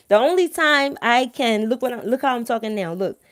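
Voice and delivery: ordinary talk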